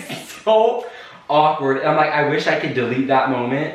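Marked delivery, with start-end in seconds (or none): 0.0-0.8 giggling through the words; laughing. Real, not acted. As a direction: laugh it out, high. Embarrassed at own story.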